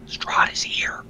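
hushed, breathy warning; fearful whisper. Acted. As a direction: whisper it as if he is listening. Frightened NPC senses Strahd nearby.

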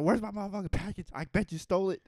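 fast, high, loud demand; mock furious accusation. Comedian acts out neighbor demanding his package, mock furious. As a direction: fire off a hammed-up angry accusation.

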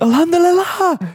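very high, strained mock war cry; imitating a shooter game voice line; battle-cry impression. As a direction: shout it like throwing a grenade in a game. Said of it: "They say that shit".

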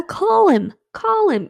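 high mocking call to a snake. Mocking characters calling snake.

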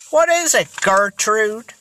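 ordinary delivery, nothing marked

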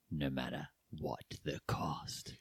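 a hushed, breathy trailer ending; dramatic whisper. Acted. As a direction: breathe the last words out.

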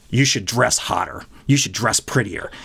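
leering guard. Retold or imitated. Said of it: "looks up and down and goes".

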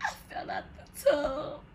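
high, soft mock sobbing; crying. Retelling her tearful call after the fall, crying. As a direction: whimper it like a tearful phone call. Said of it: "Like, I'm just crying".

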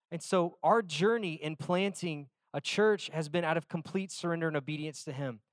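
usual speaking voice

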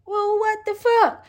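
high, stretched what the fuck; outraged disbelief. Retold or imitated. Inmates meeting, hammed-up disbelief. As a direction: pitch it way up in mock outrage. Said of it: "And they're like".

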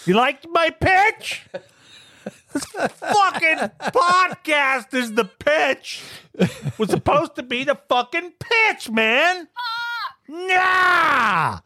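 boil over about the pitch; worked-up outburst, voice way up. Real, not acted. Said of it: "He's worked up".

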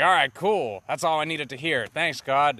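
readily agree, imitating a deluded man; blithe acceptance. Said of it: "he's like".

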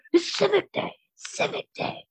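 imitated holler squeezed into a whisper; half-voiced, straining mock shout; mock strained yelling. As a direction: rasp it out like a ranting neighbor. Imitating neighbor's repeated Civic Day chant.

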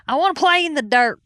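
loud, high, comic declaration; defiant. Retold or imitated. Refusing to be a country club girl, loudly defiant. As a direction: belt it out as a proud protest. Retold defiance of mother-in-law.

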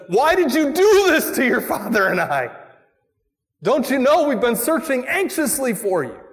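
high, loud, anxious reproach; a frightened mother scolding her son; Mary's reproach. Retold or imitated. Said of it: "She says".

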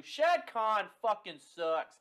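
slow, emphatic and loud; blunt mock answer. Host answering every question the same way, blunt and drawn out.

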